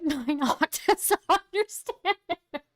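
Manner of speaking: high, broken up by laughter; laughing through words. Real, not acted. Streamer joking about the voice changer, laughing helplessly. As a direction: crack up while finishing the sentence. Cracks up mid-joke about his voice.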